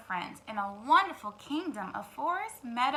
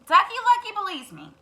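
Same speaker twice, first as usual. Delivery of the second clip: higher loud insistence; insistent hen; 8 semitones higher, 11 dB louder than usual. Acted. Hen insists to the turkey. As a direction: push back. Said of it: "said Henny Penny".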